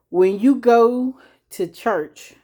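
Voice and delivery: ordinary delivery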